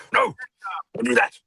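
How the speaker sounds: high, sudden cry of alarm; urgent warning. Acted. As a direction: call out a sharp high warning, acted. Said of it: "he can go".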